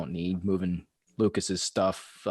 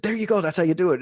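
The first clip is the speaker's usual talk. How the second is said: higher, louder praise like a cheer coach; 9 semitones higher, 6 dB louder, faster than usual. Retold or imitated. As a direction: cheer her on when she finally gets it. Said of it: "I said".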